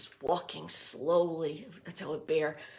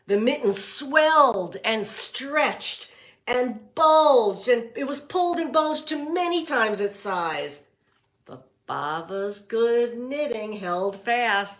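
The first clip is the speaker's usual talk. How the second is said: slow, louder, higher storybook build; dramatic read-aloud; 4 semitones higher, 6 dB louder, slower than usual. A narrator's reading. Teacher reading to class, swelling dramatic storybook voice. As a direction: stretch each word as the mitten grows.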